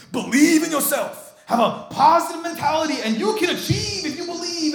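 loud, high-pitched motivational guru; mock a hype man selling self-belief; charismatic self-help speaker. Preacher imitates self-help speakers he has heard, in a loud charismatic voice. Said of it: "these guys that are very charismatic".

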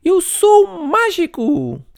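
put-on high, slow, loud proud kid; proud boast. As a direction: announce your magic to the world. Monty triumphant. (orgulhoso, agudo e lento)

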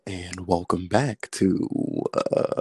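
low, slow late-night DJ; drawn-out, deep smooth radio patter; smooth jazz radio host. Shown as a demonstration. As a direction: croon the station intro slowly and low. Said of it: "smooth jazz, uh, radio specialist".

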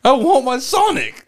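slow, high mock demand; mock tantrum. Co-host teasing the angry customer, mock tantrum. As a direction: drag it out like a thwarted child.

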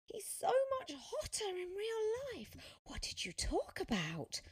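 put-on high, girlish excited voice; gushing fan girl. A narrator's reading. Fan asking Sophia about the star, gushing. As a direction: gush in a high pitch.

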